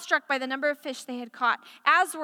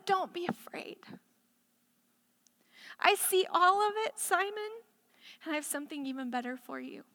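tender reassurance; 2 semitones higher, 6 dB quieter than usual. Acted. Tender voicing of Jesus.

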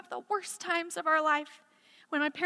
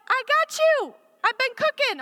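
mom reassuring; 11 semitones higher, 7 dB louder than usual. Retold or imitated.

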